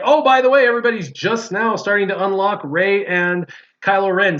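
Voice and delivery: imitating the game announcing something late; mock game announcement. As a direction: hype it up like a fake official update. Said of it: "It'll be like".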